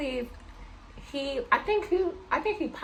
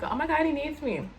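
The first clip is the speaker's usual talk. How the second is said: higher-pitched retold oh-my-God moment; mock gushing realization; 7 semitones higher than usual. Retelling how she took being needed by him, gushing. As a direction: play up being taken with the idea.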